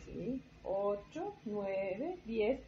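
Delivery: light buzzy bee voice counting. Acted. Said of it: "la abeja cuenta con voz de abeja".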